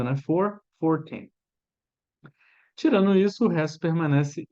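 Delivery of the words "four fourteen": "Four, fourteen" said slowly, same "four" in both; slow demo. Shown as a demonstration. Teacher showing learners the same "four" sound. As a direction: spell out "Four, fourteen" carefully. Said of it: "A pronúncia é a mesma".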